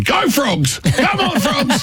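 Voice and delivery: high-pitched mock cheer; pub punter cheering. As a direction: cheer a dog on like you're at the pub with mates. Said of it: "at the pub with your mates going".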